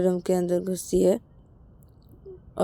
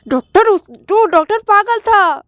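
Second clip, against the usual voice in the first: loud, high, drawn-out outburst; high insistent shout, words stretched; outraged yell; 11 semitones higher, 5 dB louder, slower than usual. Acted. Acted shouting in quarrel.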